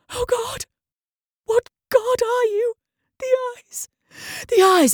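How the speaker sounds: a man losing his mind in fright; raving terror. Acted. The doctor screams at a god, high terrified cries.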